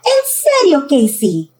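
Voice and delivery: high, loud mother's disbelief; exasperated scolding. Acted. Mom finding Casey still asleep, exasperated scolding.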